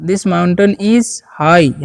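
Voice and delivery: very slow, word by word, for learners; slow dictation pace; slow model sentence. Shown as a demonstration. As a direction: spell it out slowly for the class. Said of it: "teacher models English sentence".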